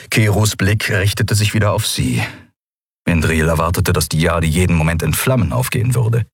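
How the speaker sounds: plain reading voice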